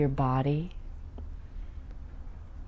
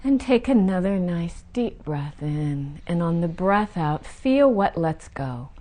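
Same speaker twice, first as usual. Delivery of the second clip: ordinary delivery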